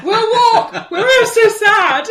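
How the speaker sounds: high, loud wail through laughter; hammed-up, helpless fake grief; mock sobbing. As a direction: wail it tearfully while cracking up. Pretended grief while helplessly laughing.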